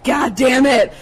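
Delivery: exasperated outburst. Retold or imitated. Woman leaving a bad date, exasperated yell. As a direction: burst out, mock-furious.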